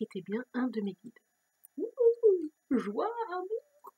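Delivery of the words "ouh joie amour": high, drawn-out gushing joy; a swooning, higher, very slow coo of delight; mock rapture. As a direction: sing out the joy slowly, hammed up. Mock joy at good news.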